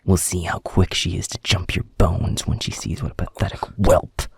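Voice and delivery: loud, forceful dressing-down; menacing threat. Acted. Alpha threatens to humiliate subordinate, angry and loud.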